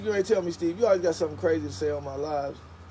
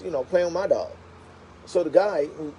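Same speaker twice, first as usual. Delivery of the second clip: ordinary talk, nothing marked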